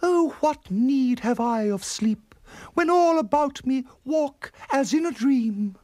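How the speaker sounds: high, slow theatrical declamation; lofty declaiming aunt. Acted. Aunt answering Missus E about sleep, lofty declaiming. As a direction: declaim it dreamily, high and drawn out.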